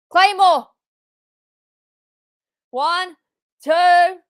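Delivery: loud, high pinfall count; real excitement; excited count. Real, not acted. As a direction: count along with the ref. Counting the pin.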